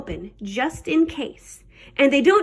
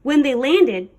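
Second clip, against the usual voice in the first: usual speaking voice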